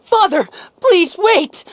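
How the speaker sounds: high, slow, pleading call to stop him; urgent plea. Acted. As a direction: cry out to halt Father. Lyney calls out to stop execution.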